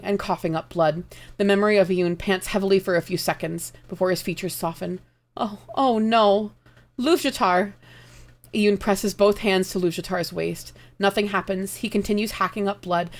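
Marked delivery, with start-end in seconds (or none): 5.4-7.7 slow horrified cry. Acted. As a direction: gasp it out in dawning horror. Yun sees Luvjatar dying.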